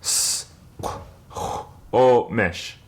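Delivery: "S, qu, o, mish." split apart with pauses; syllable by syllable again; slow syllables. Shown as a demonstration. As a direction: give each part of "S, qu, o, mish." on its own. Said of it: "we'll do this slowly".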